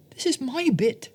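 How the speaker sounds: high, eager mouse voice; excited whisper. Acted. Mouse whispering to moose, high and eager. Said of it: "she whispered to Moose".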